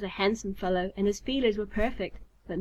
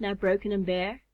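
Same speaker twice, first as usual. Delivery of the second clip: plain reading voice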